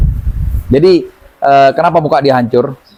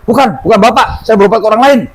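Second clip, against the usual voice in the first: higher, a hint of an annoyed retort; mock angry denial; 7 semitones higher than usual. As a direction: brush him off, a touch irritated. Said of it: "malah marah dia". (bantahan agak kesal dengan nada tinggi)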